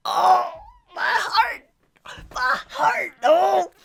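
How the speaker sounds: high, loud cries of adoration; overwhelmed squealing. Real, not acted. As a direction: cry out as if your heart is melting. Heart melting at cute character.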